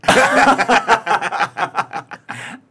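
high, loud burst of laughter; real belly laugh; long laugh. Real, not acted. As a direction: crack up helplessly. Cracking up at friend's question.

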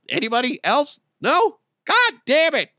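high, loud, rising mock exasperation; hammed-up desperation. Butter churner begging the crowd, hammed-up high exasperation.

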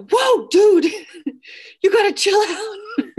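very high, loud, laughing re-enactment; startled yell. Retold or imitated. Guest startled by figure jumping out, high laughing yell. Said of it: "And I literally go".

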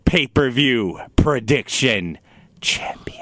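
slow, emphatic pronouncement; drawn-out announcement. Real, not acted. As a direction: stretch each word out for effect. Slowly corrects the champion announcement.

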